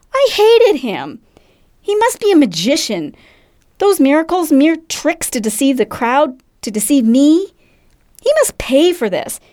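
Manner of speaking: high, heated outburst of betrayal; consumed with rage, pitch riding high; enraged. Acted. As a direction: rant bitterly as a man let down. Narrator recalls his rage.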